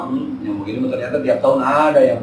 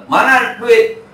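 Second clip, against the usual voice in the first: louder, higher demand for money; 9 semitones higher, 5 dB louder, much slower than usual. Retold or imitated. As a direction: bark out a demand for the cash. Lender demands money back. (menagih utang dengan lantang)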